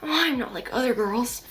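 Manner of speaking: hammed-up cartoon impression; Deku. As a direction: play a cartoon hero as a pick-me. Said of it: "I keep doing a Deku voice".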